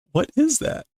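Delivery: ordinary delivery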